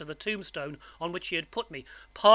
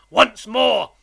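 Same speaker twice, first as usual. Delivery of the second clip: loud, raised, forceful order; menacing convict; 5 semitones higher, 12 dB louder than usual. A narrator's reading. Convict makes boy repeat his name, menacing. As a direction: demand it from a scared child.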